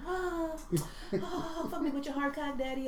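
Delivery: mock porn moan. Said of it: "the regular".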